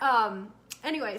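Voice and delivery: normal talking voice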